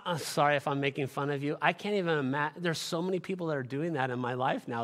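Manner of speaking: ordinary talk, nothing marked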